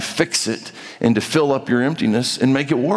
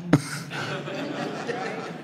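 breathy laugh; 5 semitones higher, 11 dB quieter than usual. Real, not acted. Laughing at the quoted rebuff.

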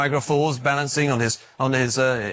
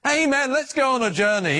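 mock eager amen; 10 semitones higher than usual. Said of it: "jumping up and down and saying".

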